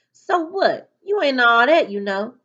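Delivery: loud, high-pitched comeback; scornful retort. Acted. John John puts Malika down in the hallway, scornful retort. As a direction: throw it back dismissively, starting high and loud. Acted quarrel, bully's retort.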